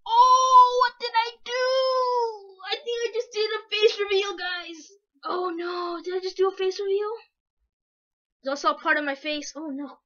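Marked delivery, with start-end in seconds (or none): 0.1-7.1 high, loud startled cry; shocked panic. Real, not acted. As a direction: panic at a sudden mistake. Accidental face reveal panic.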